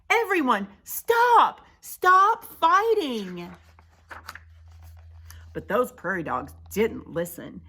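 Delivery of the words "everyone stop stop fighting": high, loud drawn-out plea; urgent shout. Acted. Pipsqueak calling on everyone to stop fighting, urgent shout.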